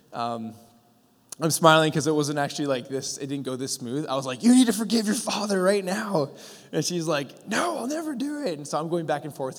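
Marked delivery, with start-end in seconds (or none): 4.4-6.3 louder, higher, insistent urging; retold urgent demand, pushed up and out; forceful command. Acted urging during a deliverance quarrel. 7.5-8.6 much higher-pitched mock refusal; defiant refusal. Acted defiant refusal in retold quarrel.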